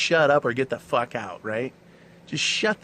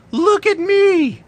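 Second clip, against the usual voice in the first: high, loud, drawn-out shriek of a woman craving attention; mocking scream; 11 dB louder, slower than usual. Mocking tattooed women screaming for attention, loud shrill shriek. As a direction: shriek it slow and high like a caricature begging to be seen. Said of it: "to scream at you from further distances".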